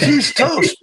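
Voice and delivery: furious vow. Retold or imitated. Jim furiously vows revenge on Jeff. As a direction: spit it out like a sentence passed.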